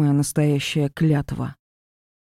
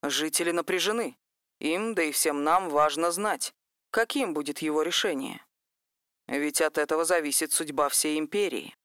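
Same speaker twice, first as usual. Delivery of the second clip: news anchor; 8 dB quieter than usual. Acted.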